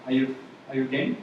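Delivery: ordinary delivery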